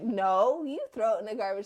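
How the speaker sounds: loud NO, then quick comeback; insistent parent retort. Retold or imitated. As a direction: fire the order right back at the child. Mom's retort to her son.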